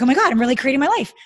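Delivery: rising, breathless thrill of taking the reins; excited inner voice. Retold or imitated. Woman voicing her excited inner self to her guest, loud and fast. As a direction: gush it out fast and loud, scared but thrilled.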